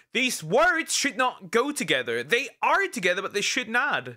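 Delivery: high-pitched, exasperated objection; emphatic protest. Real, not acted. Streamer objecting to words that should not go together, emphatic protest. Repeats the objection, appalled by title.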